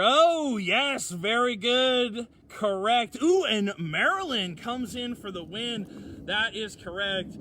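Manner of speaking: loud, high quiz-host cheer; excited game host. Real, not acted. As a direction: cheer the winner like a game show. Cheering viewers' right guesses.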